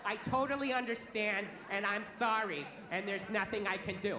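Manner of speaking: low and meek customer-service voice; apologetic call center rep. Retold or imitated. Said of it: "they're always sorry".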